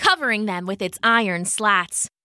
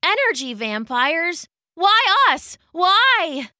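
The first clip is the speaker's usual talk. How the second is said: higher, pleading questions; distressed questioning; 6 semitones higher, 3 dB louder than usual. A narrator's reading. Victim asks the energy vampires why, distressed. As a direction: plead upward in dismay.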